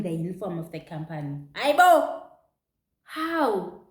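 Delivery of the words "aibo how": shocked outcry. Real, not acted. Shock at seeing others hired.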